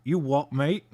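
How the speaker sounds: a light mock London townie accent; half-hearted Cockney impression of the inner city. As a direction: toss off a playful Cockney "You what, mate?". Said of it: "and now it's".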